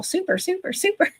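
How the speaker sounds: put-on high, sing-song Valley girl. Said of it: "I sound like a Valley girl".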